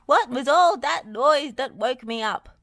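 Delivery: loud, high grumbling question; bunged-up complaining shout; calling out. A narrator's reading. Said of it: "Bob called".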